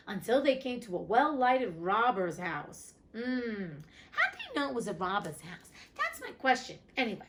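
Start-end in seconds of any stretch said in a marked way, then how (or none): none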